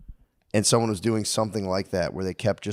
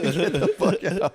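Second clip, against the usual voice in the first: slow, higher, laughing out the words; laughing mock dismissal; 6 semitones higher, slower than usual. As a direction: laugh it off in disbelief.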